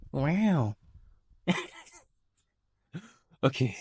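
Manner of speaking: ordinary talk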